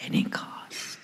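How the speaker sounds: fading whispered prayer, slow; whispered surrender. Retold or imitated. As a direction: let it fade to a breath.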